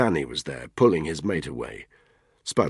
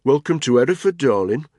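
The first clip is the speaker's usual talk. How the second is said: bland; 9 semitones higher, 7 dB louder than usual. Acted. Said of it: "Tony said blandly".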